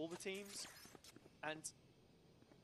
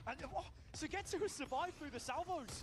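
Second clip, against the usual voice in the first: excited; 9 semitones higher than usual. Real, not acted. Caster reacting to survival.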